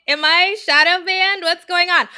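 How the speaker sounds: loud, high-pitched playful panic; mock alarm. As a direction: act out being alarmed, half joking. Acting out her alarm at empty chat.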